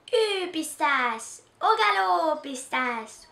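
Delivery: little sister spurring her big sister like a pony; commanding call. Acted. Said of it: "commande Pauline".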